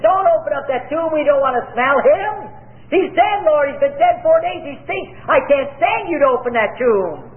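high-pitched mock complaint from a doubting woman; Martha protesting. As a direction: whine it up high like a fussy sister. Preacher voicing Martha's protest.

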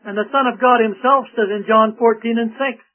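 usual speaking voice

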